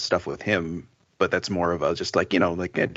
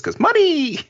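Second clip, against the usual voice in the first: high, louder, laughing "money!"; higher and louder cynical joke; gleeful punchline; 5 dB louder, slower than usual. Real, not acted.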